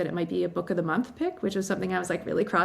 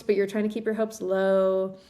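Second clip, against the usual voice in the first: normal talking voice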